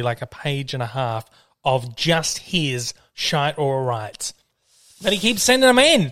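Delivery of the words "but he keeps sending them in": high, loud complaint; exasperated. Real, not acted. Host moans about a listener flooding submissions, exasperated. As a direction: raise the voice in exasperation.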